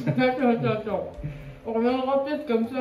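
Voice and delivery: breathless laughing pleas. Real, not acted. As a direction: laugh through the words. Laughing reaction to heat.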